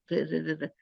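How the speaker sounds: र quiet and light, not heavy रु; thin ra. Shown as a demonstration. Teacher shows the light ra sound to students. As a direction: repeat र lightly, never रु. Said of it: "रु नहीं आवाज आएगी".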